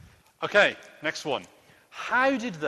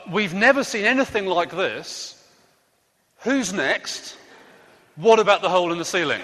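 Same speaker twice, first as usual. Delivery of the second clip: a louder, lightly hammed-up crowd voice that tips into a laugh; mock amazed crowd; 3 semitones higher, 6 dB louder than usual. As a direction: play the gawping crowd for laughs, a touch over the top. Leader jokingly voices the amazed crowd.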